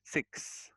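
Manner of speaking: "Six" with a clear closing s; "Six", modelled with its s audible; correct final S. Shown as a demonstration. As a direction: stress the ks ending of "Six". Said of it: "ada S-nya".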